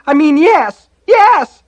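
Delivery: very high, loud, frantic agreement; terrified child giving in; panicked shouting. A narrator's reading. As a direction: shout it desperately, rising into a shriek. Boy frantically corrects his screamed answer.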